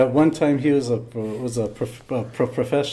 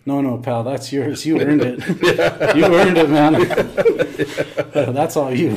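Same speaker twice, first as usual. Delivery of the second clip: louder, laughing bartender voice; laughing imitation of Larry; 6 dB louder than usual. Old bartender to young coworker, laughing and generous. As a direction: chuckle it out like a pleased old barman. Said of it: "he puts his hand on it".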